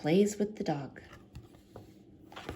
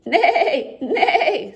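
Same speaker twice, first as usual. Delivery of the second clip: high, loud neighing; horse neigh; 10 semitones higher, 10 dB louder than usual. A narrator's reading. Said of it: "says the horse".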